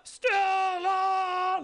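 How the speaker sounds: long anguished high yell; yelling Stella. Retold or imitated. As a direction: howl the name up high. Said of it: "he's yelling out Stella".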